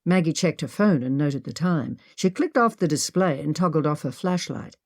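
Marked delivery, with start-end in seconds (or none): none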